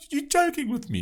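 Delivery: high-pitched mock disbelief; incredulous scoff. Answering would-be planet savers, incredulous.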